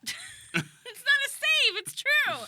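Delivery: high, loud, drawn-out squeal; squealed protest. Real, not acted. Protests a friend's tease.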